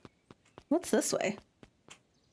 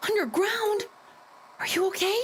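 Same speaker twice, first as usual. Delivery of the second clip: shocked worry; 10 semitones higher than usual. Acted. Shocked reply.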